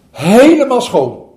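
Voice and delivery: slow, loud and high exclamation; loud delighted praise. Acted. Zen master praising the boys, loud delight. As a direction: burst out in delight. Master admires the clean basket.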